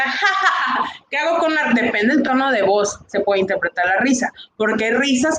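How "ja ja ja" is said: high, bright ha-ha-ha laugh shown as an example; short staged laughter for the class; demo laugh. Shown as a demonstration.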